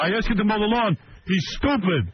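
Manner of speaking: hammed-up griping dad; mocking dad. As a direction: whine it like a fed-up father. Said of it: "they would discuss in front of me".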